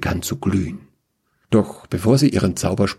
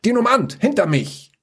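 high urgent call to get behind her; 13 semitones higher than usual. Acted. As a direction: shout a warning to her companion. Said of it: "Luca rief".

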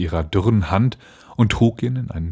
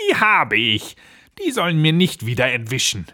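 higher-pitched gloating witch; malicious sneer; 5 semitones higher than usual. Acted. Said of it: "lachte sie boshaft und sprach höhnisch". (höhnisch und boshaft)

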